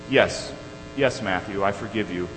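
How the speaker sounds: low, soft fatherly reassurance; retold humbled dad, gently pausing; quiet, sincere acted reply; tender forgiveness. Father forgives his son, softened.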